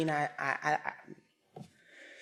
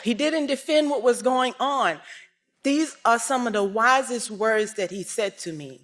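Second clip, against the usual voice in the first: normal talking voice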